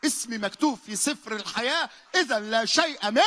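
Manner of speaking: high and loud unison declaration; loud proclamation. Shown as a demonstration. Preacher leads the church in a loud declaration. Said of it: "وقول معايا".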